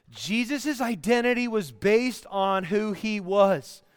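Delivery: ordinary talk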